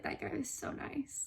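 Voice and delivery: soft whisper imitating dad; whispered dad quote. Daughter imitating dad bragging about the clerk, whispered. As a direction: breathe dad's praise softly. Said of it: "for him to be like".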